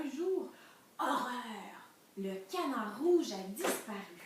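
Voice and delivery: loud, suspenseful storybook narration; dramatic story reading. A narrator's reading. Teacher reading of the missing red duck, dramatic storytelling. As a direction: announce the calamity to young listeners.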